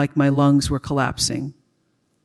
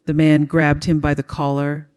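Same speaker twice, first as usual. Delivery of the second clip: ordinary delivery, nothing marked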